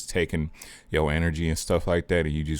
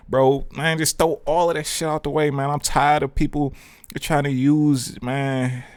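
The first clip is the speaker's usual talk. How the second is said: exasperated, pitched well up from usual talk; real frustration, voice riding high; fed-up outburst; 10 semitones higher than usual. Real, not acted. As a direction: vent like you've had enough of it. Fed-up outburst at fear-based content.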